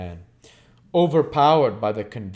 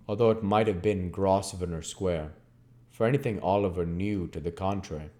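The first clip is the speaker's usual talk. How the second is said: ordinary delivery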